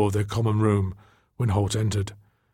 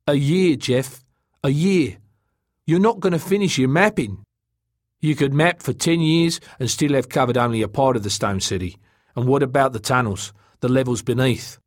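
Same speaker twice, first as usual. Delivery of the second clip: raised, forceful arguing voice; hard-headed explorer, exasperated; 4 semitones higher, 4 dB louder than usual. Acted. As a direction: press the objection, pitched up and emphatic.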